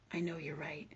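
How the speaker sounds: soft hushed whisper of the daughter agreeing. Retold or imitated. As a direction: breathe it as a whispered admission. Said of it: "she tells me".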